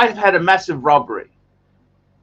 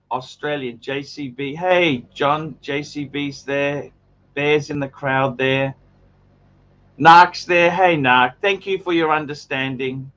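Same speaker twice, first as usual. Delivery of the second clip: ordinary delivery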